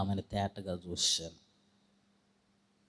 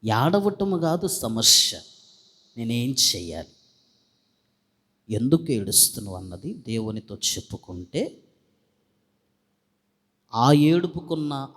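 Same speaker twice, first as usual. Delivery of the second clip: fervent preaching; 3 semitones higher, 6 dB louder than usual. Acted. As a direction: preach it out to the congregation with fire. Preacher rises to full force. (ఉద్వేగంతో బిగ్గరగా ప్రసంగం)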